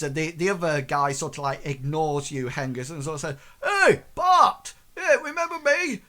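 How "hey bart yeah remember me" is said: high, loud eager call; guard hailing someone. Acted. Guard calls to Bart who ignores him, high eager shout. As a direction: shout to get an old acquaintance's attention. Acted guard hails acquaintance over crowd.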